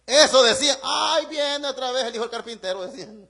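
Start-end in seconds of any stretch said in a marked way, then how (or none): 0.8-2.9 imitating fed-up townsfolk; mock dismay. Nazareth townsfolk seeing the carpenter's son return, mock dismay. Mimics scornful people of Nazareth.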